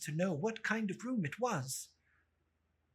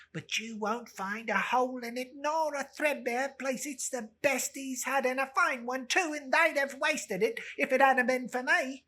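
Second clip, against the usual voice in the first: put-on louder, higher brassy old woman; old charwoman; 7 semitones higher, 6 dB louder than usual. Old woman boasts about the shirt, higher and loud. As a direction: brag it like a shameless crone.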